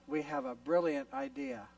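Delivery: ordinary talk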